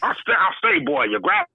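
Foghorn Leghorn. Retold or imitated. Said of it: "sound like Foghorn Leghorn".

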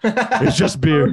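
high, slow giggly movie line; high giggling recital; laughing quote. Retold or imitated.

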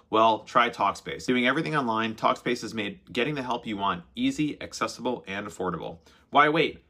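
smooth podcast ad read voice. Acted. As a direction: read the sponsor spot steadily. Sponsored ad read.

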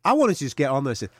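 normal talking voice

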